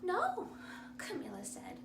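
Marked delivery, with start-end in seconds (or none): 0.0-0.5 put-on high, louder girl's no; a small firm no; little girl. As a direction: Camilla to the doctor, high. Camilla answers the doctor.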